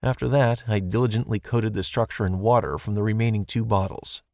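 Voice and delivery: usual speaking voice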